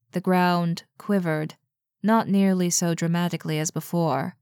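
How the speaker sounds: ordinary delivery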